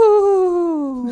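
spooky ghost wail. Shown as a demonstration. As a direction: moan like a ghost. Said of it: "like a ghost".

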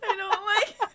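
high laughing cry-whine. Real, not acted. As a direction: wail it while cracking up. Hysterical laughing rant.

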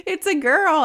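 high, loud mock cheer; mock screaming. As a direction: call it out like the whole room shouting. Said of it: "They're, like, screaming".

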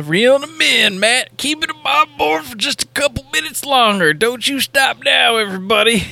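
high-pitched put-on showman voice; teasing, high-pitched barker act; mock hype man. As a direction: ham it up as if keeping an audience hooked, stretching the ending. Hammed-up teasing of host's hook.

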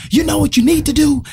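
high-pitched mock yell; imitated dad yelling. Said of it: "he yells out at us".

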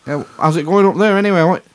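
voicing dad. Dad voiced on phone call.

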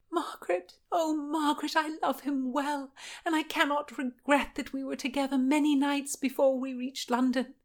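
high, plaintive confession; imploring Anne. Acted. Anne confesses her love to Margaret, imploring.

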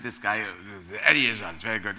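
mock-posh agent recommending a client. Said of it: "gave him the posh voice".

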